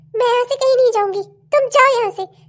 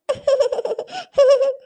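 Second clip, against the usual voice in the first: a short taunting laugh at him; scornful laugh; mocking laugh. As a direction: laugh in his face.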